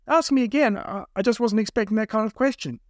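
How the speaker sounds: high, anxious stammering request; flustered plea. A narrator's reading. Captive to kidnappers after failing, flustered pleading. Captive begs for another chance.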